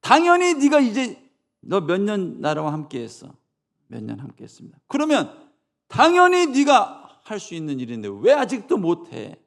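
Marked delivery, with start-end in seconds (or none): 0.0-3.4 loud, high rebuking question; scolding question. Acted. Jesus grilling a disciple, loud scolding question. 4.9-9.4 loud, high scolding rebuke. Acted. Jesus scolding a disciple, loud rebuke.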